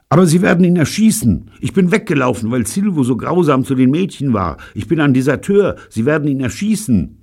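very high, loud and panicky; frantic warning. A narrator's reading. Deserter warns captors their friend will be shot, frantic. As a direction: plead urgently, voice pitched right up.

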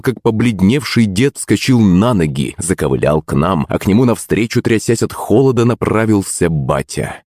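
usual speaking voice

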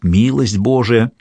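ordinary delivery, nothing marked

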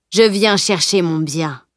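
ordinary delivery, nothing marked

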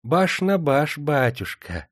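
put-on high, sugary old crone voice; syrupy, ingratiating old woman; unctuous old woman. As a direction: fawn over him like a sly old moneylender. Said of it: "елейно отозвалась она".

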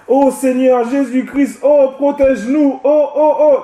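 high, hammed-up pleading prayer; mock pleading prayer. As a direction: mimic a believer begging with exaggeration. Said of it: "des chrétiens qui vont invoquer". (imitation moqueuse d'une prière suppliante, voix haute)

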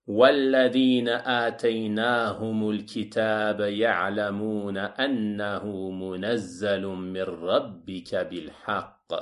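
even, sustained scripture recitation; Quran recitation. Acted. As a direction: recite the verse with long vowels, sinking at the end. (récitation coranique soutenue)

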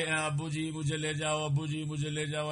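hint of a tearful plea for ابو جی; mock weeping plea. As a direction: lightly mimic a sobbing child begging. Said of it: "رو رو کر وہ کہتی تھی".

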